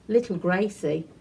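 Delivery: ordinary delivery, nothing marked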